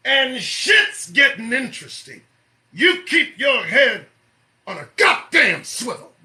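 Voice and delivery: urgent warning. Real, not acted. Host warning listeners to stay alert, emphatic and loud. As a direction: drive each word home forcefully.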